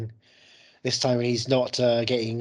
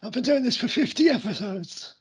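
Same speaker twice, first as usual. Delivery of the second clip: high-pitched mock old-timer; comic high voice, playfully put on; silly put-on voice; 12 semitones higher than usual. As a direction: complain about being old in a silly high voice. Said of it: "in that voice".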